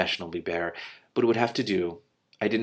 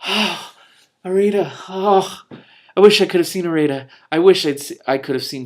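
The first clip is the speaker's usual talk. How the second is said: high, loud, choked-up wish; getting emotional; 9 semitones higher, 10 dB louder than usual. Real, not acted. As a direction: let the feeling break through, loud and high. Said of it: "I'm about to get really emotional".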